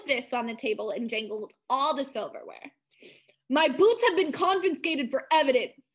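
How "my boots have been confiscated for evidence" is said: high, loud outraged announcement; loud declaration. A narrator's reading. Mongo to the table after slamming his fists, loud declaration. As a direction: declare it loudly and higher. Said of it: "he declared".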